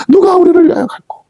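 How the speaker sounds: high-pitched, agitated cry for help; imitated anxious plea. Preacher voices God fretting for a messenger, anxious high plea. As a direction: cry it out high, like a desperate parent. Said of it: "안절부절하는 거예요".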